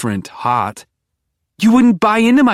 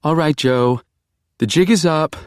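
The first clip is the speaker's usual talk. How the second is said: higher, lilting, a bit too cheerful; sing-songy; 8 semitones higher than usual. A narrator's reading. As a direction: sing it out to cover nerves. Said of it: "too sing-songy".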